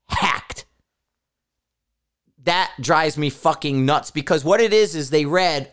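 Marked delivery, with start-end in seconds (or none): 0.1-0.7 "hacked" dropped soft and breathless, sarcastic; air-quoted sarcasm. Shown as a demonstration. Owner mocking members' hacking excuses, whispered sarcastic "hacked". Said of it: "air quotes".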